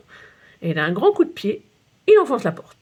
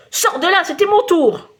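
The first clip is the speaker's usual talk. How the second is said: put-on higher and louder angry child's shout; child yelling; 9 semitones higher, 5 dB louder than usual. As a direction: bang on the door and yell. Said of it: "hurle-t-il". (Eudes hurle contre Clotaire, cri d'enfant)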